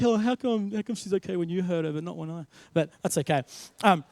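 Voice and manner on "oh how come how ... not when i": lightly voiced put-out little boy; aggrieved young son. Son complains to dad about his sister's double standard, mildly aggrieved. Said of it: "poor Maddie was like".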